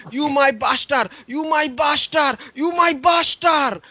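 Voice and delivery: high, slowing plea of a man with a cut finger; injured colleague pleading with the nurse in broken English; broken-English immigrant worker. Retold or imitated. Dad's injured colleague at hospital.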